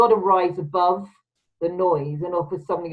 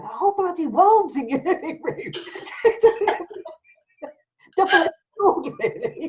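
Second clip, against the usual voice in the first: words breaking into a laugh; amused, laughing aside; 10 semitones higher than usual. Real, not acted. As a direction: laugh as you say it. Laughing through the remark.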